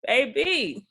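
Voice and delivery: high, hammed-up East Coast accent; playful East Coast accent impression; mock East Coast talk. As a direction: mimic a fast East Coast speaker for fun. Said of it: "it's like".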